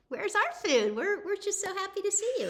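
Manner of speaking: high, fast, eager little rat voice; voicing the rats. Mother voicing the rats greeting her kids, high and eager. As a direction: chirp it out like a happy rat wanting dinner. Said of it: "as if to say".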